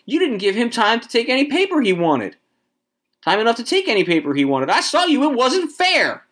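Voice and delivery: high-pitched indignant cry; shouted protest. A narrator's reading. Wife protesting to Mister Summers, shouted. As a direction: protest shrilly, pitch soaring. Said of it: "shouted to Mister Summers".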